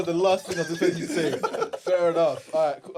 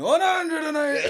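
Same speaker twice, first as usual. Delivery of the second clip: slow, drawn-out, higher mock darts call; darts commentator impression; 11 semitones higher, 4 dB louder, slower than usual. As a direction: ham up a darts score announcer.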